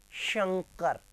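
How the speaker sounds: शंकर with a strong initial hushed sh; correct श pronunciation. Shown as a demonstration. Teacher asking the name with श, careful शंकर. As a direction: open शंकर on a long, clear श. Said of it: "श से क्या नाम है?".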